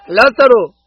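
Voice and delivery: calling Lazarus out of the tomb, loud and strong; a loud, ringing cry of the name. Acted. Preacher voicing Jesus calling to the dead Lazarus, loud cry. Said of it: "él clamó con fuerte voz".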